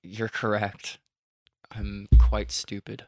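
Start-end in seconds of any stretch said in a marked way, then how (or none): 1.7-3.0 mock self-deprecation. Mock confession.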